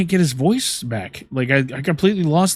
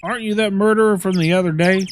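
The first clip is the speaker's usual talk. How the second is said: higher, louder character voice; lightly put-on, raised voice; game character; 5 semitones higher, 3 dB louder than usual.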